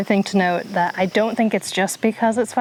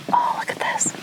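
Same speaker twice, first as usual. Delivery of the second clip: whispering; 3 dB quieter than usual. Real, not acted. Whispering on the quiet trail.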